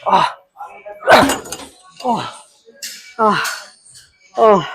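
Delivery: high strained gasps after the lift; straining groans. Real, not acted. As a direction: groan out in exhaustion. Failing the last rep.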